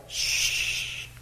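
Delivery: a breathy shushing hiss; soft hush. Retold or imitated. Jesus hushing the people who ask about him, a soft shushing. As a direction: hush them gently like a secret.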